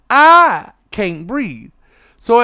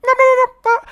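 much higher, louder no no no; squealing no; 5 dB louder, much faster than usual. Real, not acted. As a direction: shriek of horrified refusal. Horrified refusal.

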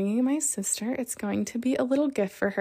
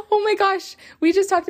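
high, louder laughing gasp; laughing exclamation; 11 semitones higher, 5 dB louder than usual. Real, not acted. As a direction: burst out amused at yourself. Realizes ten minutes on [name].

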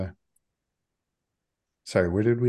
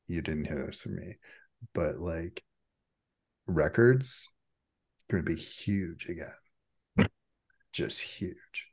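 secretive hush; 3 semitones lower, 5 dB quieter than usual. Acted. To a stranger in the desert, confiding a secret in a hush. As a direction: drop to a hushed, conspiratorial near-whisper.

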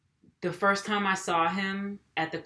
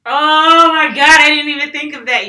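excited agreement; 7 semitones higher, 15 dB louder than usual. Real, not acted. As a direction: burst out in delighted recognition. Excited agreement with co-host's pet peeve.

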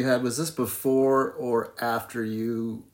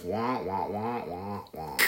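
muffled wah wah talk; 4 semitones lower, 6 dB quieter, much slower than usual. Retold or imitated. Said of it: "Hit the Gen X button".

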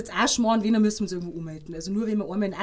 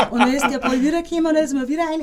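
higher and louder, laughing through it; 6 semitones higher, 8 dB louder, faster than usual. Real, not acted. Goalkeeper telling the host about conceding again, laughing. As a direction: laugh at your own blunder.

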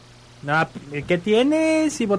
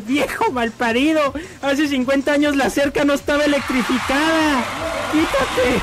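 put-on higher, indignant old wife, climbing to the end; scolding old woman; 4 semitones higher than usual. Old wife curses her husband by the fence, indignant. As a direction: scold him, ending in a high squeal. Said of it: "Le dice".

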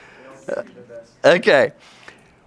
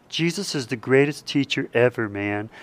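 casual offhand; 3 semitones lower, 6 dB quieter than usual. Retold or imitated. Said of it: "When people say".

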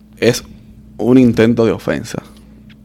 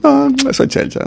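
mock dismissive friend; 14 semitones higher than usual. Friend's reply quoted.

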